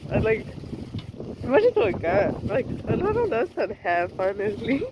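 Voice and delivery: amused, talking through laughter; laughing. Real, not acted. Laughing after failing.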